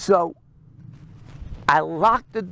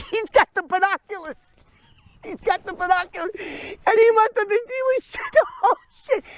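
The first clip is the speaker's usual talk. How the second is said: very high voice through laughter; laughing while talking; 13 semitones higher than usual. Real, not acted. Storyteller picturing Roy watching through binoculars, high laughing delivery. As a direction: crack up as you tell it.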